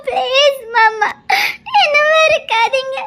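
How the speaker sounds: slow, crying, begging; cling to him through tears; weeping wife begs not to be hated; sobbing plea. Acted.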